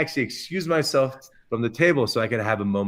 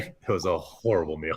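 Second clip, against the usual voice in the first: normal talking voice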